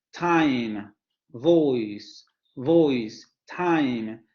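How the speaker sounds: "Time" and "voice" with the stress on the first vowel; English diphthongs. Shown as a demonstration. Teacher modelling diphthong examples for the class. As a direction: model each diphthong carefully. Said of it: "la tonicidad recae en el primer elemento".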